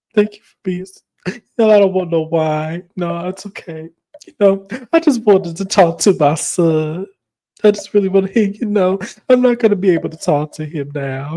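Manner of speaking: mock-weepy martyr act; hammed-up wounded mother, playing for sympathy; putting on. Host mimics castmate lamenting missed call with son, hammed-up woe. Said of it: "putting on. Y'all, when I tell y'all, I'd have thought Meredith was an actress".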